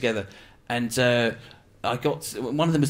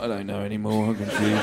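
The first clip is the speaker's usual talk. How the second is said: confused phone; 6 semitones lower than usual. Acted. Worn-out iPhone admitting it's lost, low and dazed. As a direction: mumble it like a muddled old gadget.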